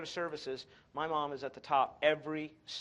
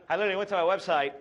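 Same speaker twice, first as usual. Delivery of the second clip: excited rush; 3 semitones higher, 9 dB louder, faster than usual. Retold or imitated. Panicked retelling of erased site.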